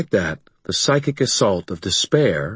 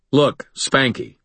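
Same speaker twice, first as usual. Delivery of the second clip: taunting; 6 semitones higher, slower than usual. Acted. Harry taunts the monster.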